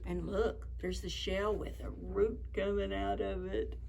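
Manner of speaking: ordinary delivery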